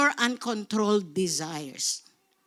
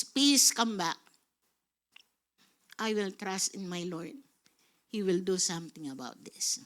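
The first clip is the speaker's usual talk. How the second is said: low, hushed, settling into softness; quiet trusting plea; 9 semitones lower, 6 dB quieter than usual. Acted. Preacher telling lost peace to come back, low and quiet. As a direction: talk yourself down gently, low and soft. Calm address to one's peace.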